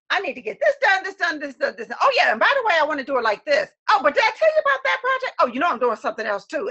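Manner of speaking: rushed, scattered rambling that speeds up; rambling fast. Retold or imitated. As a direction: pile on one task after another, all over the place. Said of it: "That's how I was talking".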